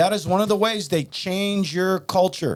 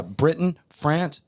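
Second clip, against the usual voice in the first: usual speaking voice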